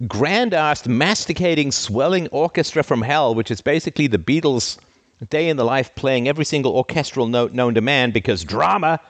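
ordinary talk

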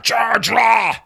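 high, loud battle cry; mock bull charge shout. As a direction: yell it like a charging crowd. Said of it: "like bulls with a red flag".

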